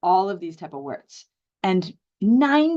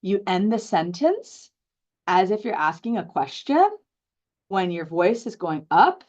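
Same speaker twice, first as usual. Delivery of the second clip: uptalk, each of "sentence", "question", "up" swung upward; upward inflection. Shown as a demonstration. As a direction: pitch rising on "sentence", "question" and "up". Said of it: "upward inflection. Upward inflection is when".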